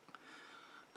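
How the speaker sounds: very soft, breathy laugh; soft chuckle. Acted. Vampire likening captive to wine, soft chuckle. Vampire amused by own comparison.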